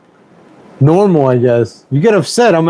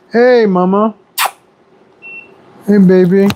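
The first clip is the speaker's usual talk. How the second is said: cooing to pet; 5 semitones higher than usual. Real, not acted. Greeting his pet affectionately.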